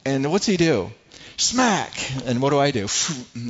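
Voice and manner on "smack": a sudden, high, loud "Smack!" like a slap; loud smack sound. Retold or imitated. Son slaps chest, coffee spills.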